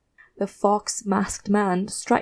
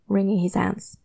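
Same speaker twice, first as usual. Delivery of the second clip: usual speaking voice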